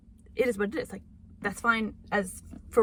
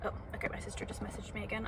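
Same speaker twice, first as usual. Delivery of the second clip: whispering; 11 dB quieter, faster than usual. Real, not acted. Aside about sister's message, whispered.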